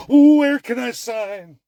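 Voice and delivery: high, loud fake-keen gush; hammed-up eager cry; mock eagerness. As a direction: imitate someone falling over themselves to sign. Voicing countries eager to sign deals.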